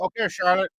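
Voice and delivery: ordinary talk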